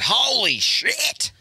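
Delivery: put-on high slow drawn-out kid exclamation; kid's outburst. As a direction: stretch it out like an amazed little boy. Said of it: "he goes".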